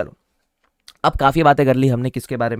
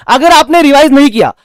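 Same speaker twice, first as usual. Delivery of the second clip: sudden shouted warning, much higher and louder; mock stern shout; 11 semitones higher, 11 dB louder than usual. Teacher warning students who skip revision, in a playful shout. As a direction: bark the threat at the class.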